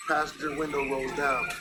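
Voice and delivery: ordinary talk, nothing marked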